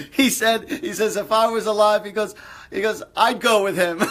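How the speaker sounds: can barely get the joke out for laughing. Real, not acted. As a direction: still laughing while relaying the punchline. Relaying joke while still laughing.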